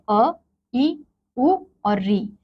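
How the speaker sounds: slow, clear vowel sounds अ, ई, उ, री; short vowels drawn out. Shown as a demonstration. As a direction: sound out each vowel slowly for the class. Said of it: "इनके उच्चारण में हमें कम समय लगा". (धीरे-धीरे स्वरों का उच्चारण)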